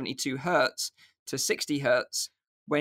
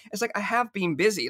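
higher-pitched, mock indignant comeback; defensive protest; 6 semitones higher than usual. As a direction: protest to your wife, playing it up. Acting out protest to wife.

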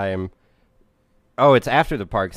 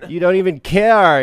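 higher and louder, slow outraged whine; mock complaint; 7 semitones higher, 8 dB louder than usual. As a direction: whine it out loud, high. Acting the argument.

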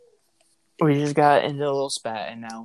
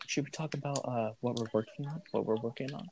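much softer, tentative aside; hushed prompting; 9 dB quieter than usual. Real, not acted. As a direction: quietly check with your co-host. Quietly prompting co-host about topic.

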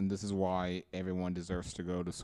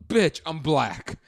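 loud, high mock-outraged comeback; indignant retort; 7 semitones higher, 15 dB louder than usual. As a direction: shout them down, retelling your comeback. Retold angry comeback to coworkers.

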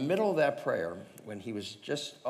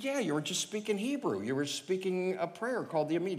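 much higher, earnest insistence; eager insisting; 10 semitones higher than usual. Retold or imitated. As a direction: convince him, eager. Insisting to the stranger.

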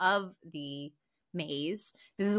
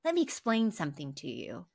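condescending husband; 2 semitones higher than usual. Retold or imitated. Husband scolding his wife for interrupting, coldly patronizing. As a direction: explain it coldly like to a child. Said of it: "he's like".

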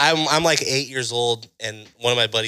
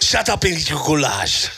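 slow mock German scold; a gruff German grandma; German accent; 3 semitones higher, slower than usual. German grandma scolding grandson, mock German accent. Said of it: "That's a pretty good German".

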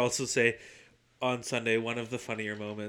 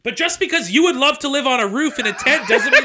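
loud, high, fast laughing outburst; laughing rant; 16 dB louder, faster than usual. Real, not acted. Arguing with Josh about roof living, laughing shout. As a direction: yell it through laughter at a friend.

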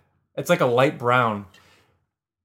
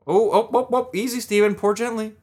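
self pep talk; 8 semitones higher than usual. Real, not acted. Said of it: "Give myself a little pep talk here".

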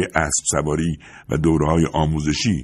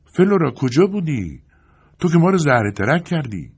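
half-scolding call to a pet, voice raised in pitch; calling out to the goat; 9 semitones higher, 3 dB louder than usual. A narrator's reading. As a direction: call out to a strayed goat, pitch lifted high. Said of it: "بز را صدا کرد و گفت".

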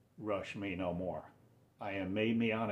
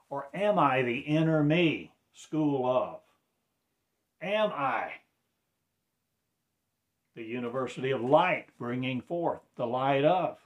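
louder, higher preaching burst; preaching swell, louder and higher; fervent preaching; 5 semitones higher, 7 dB louder than usual. Acted. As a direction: lift it up into a fervent pulpit cry. Rising preaching outburst.

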